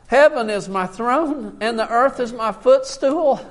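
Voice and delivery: loud, driving proclamation of God's words; sustained full-voiced Bible reading; preached scripture reading. Real, not acted. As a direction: declare it forcefully from the pulpit, line after line. Reads God's words at full force.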